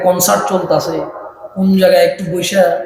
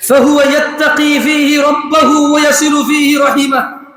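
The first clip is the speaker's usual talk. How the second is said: full-force preaching; 7 semitones higher than usual. Acted. Preacher drilling the gathering in a hadith, full-force preaching. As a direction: preach it out, calling for answers.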